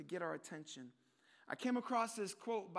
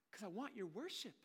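soft, hushed; 9 dB quieter than usual. Pretend. Preacher quietly voicing God wanting worship. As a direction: say it under your breath. Quiet first take of God's plea.

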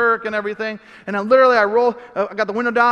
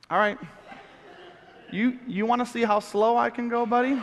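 laughing taunt; 4 semitones higher than usual. Retold or imitated. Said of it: "And I'm just like".

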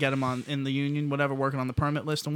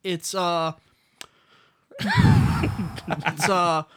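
breaking up laughing mid-quote; loud, high, cracking up while reading; laughing through it; 6 semitones higher, 6 dB louder, much slower than usual. Retold or imitated. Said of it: "she's like".

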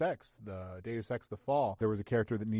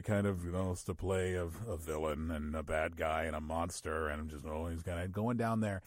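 lower-pitched, deep monster-villain tone; 5 semitones lower, 3 dB quieter than usual. Shown as a demonstration. Actor explaining he always plays villains, deep voice. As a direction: sink low into a bad-guy register. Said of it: "I have a deep voice".

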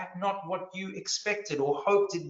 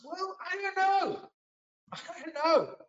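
comic puzzlement; 14 semitones higher than usual. Acted. Preacher answering his own question on bigger works, comically high-pitched. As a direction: plead ignorance in a silly high voice.

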